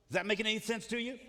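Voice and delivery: ordinary delivery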